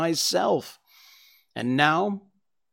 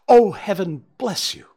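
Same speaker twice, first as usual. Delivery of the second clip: overwhelmed relief; 7 semitones higher, 9 dB louder than usual. Acted. Thief's relief, Holmes cuts him.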